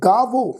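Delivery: ਗਾਵੋ with a heavy v gliding into "o"; weight the vav in ਗਾਵੋ, blending it into o; blended, weighted v. Shown as a demonstration. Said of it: "ਵਾਵੇ ਅੱਖਰ ਦੀ ਮਿਲਵੀ tone ਨਾਲ ਪਾਠ ਉਚਾਰਨ ਕਰਾਂਗੇ". (ਗਾਵੋ, ਵਾਵੇ ਦੀ tone ਭਾਰੀ ਕਰਕੇ)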